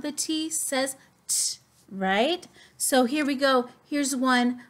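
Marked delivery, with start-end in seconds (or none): none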